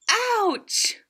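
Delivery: high, sharp yelp; mock ouch. Rhino pricked by the spiky lizard, high yelp.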